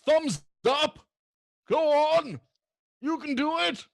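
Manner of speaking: high, loud encouraging yells; rousing shouts of encouragement; cheering shout. Acted. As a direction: cheer him on from across the room. NPC cheering on a friend.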